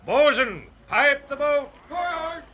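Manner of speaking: high, loud ship captain's call; calling orders. Acted. Captain to his bosun before launching, calling out orders. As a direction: sing out orders across the deck.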